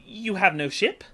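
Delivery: ordinary talk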